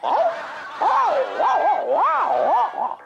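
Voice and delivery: high, drawn-out "वाओ" barks; posh dog bark. Acted. Rich people's dog barking in English, high and drawn out. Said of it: "English में भौंकते हैं".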